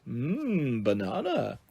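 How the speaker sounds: softly dreamy banana lover; gorilla savouring food. A narrator's reading. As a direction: relish it with a drawn-out mmm.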